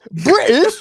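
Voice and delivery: high-pitched questioning British put-on; mock British. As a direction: squeak it in a mock British accent. Mocking friend as British.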